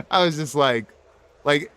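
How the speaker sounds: ordinary talk, nothing marked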